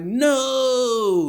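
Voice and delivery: high, loud, drawn-out wail of denial; hammed-up indignant howl; mock big fit. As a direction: imitate someone throwing a fit. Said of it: "he's throwing a big fit".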